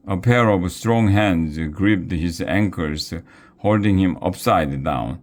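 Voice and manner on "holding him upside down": ordinary delivery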